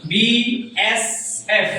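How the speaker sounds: BSF drawn out very slowly, letter by letter; slowed spelling demo. Shown as a demonstration. Teacher to pupils, BSF spelled out very slowly. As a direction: stretch BSF out so each letter is heard. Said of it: "প্রথমে আমার কি আছে".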